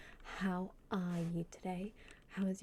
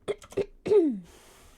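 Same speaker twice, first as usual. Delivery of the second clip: loud theatrical throat clear; 8 semitones higher, 13 dB louder than usual. Acted.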